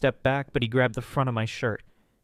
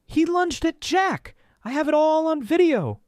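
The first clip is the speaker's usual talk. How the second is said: yelling; 6 dB louder than usual. A narrator's reading. Wife yells that she filmed the attack, high and loud. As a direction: shout the truth over the chaos. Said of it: "Sophie yelled".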